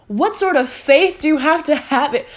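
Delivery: high, drawn-out yell of Delusional; triumphant shout. Real, not acted. To the cheering crowd, closing her story, a long triumphant shout. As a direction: belt it out like a battle cry.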